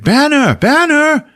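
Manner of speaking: high, loud imitated call. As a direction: shout the name to get a friend's attention. Thor calls out to Hulk.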